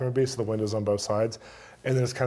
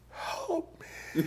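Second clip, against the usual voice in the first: high, thin, stretched-out plea; entranced cry for help; 10 dB quieter than usual. Retold or imitated. Assistant pleading to me from his demonic trance, eerie high cry. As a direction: imitate a man in a trance, voice way up high. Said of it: "assistant and he's going".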